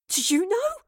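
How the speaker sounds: high, tense accusing question; hissed. A narrator's reading. Astrid to the old woman, an accusing hiss. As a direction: hiss it at her, pitched high. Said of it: "Astrid hissed at the old woman".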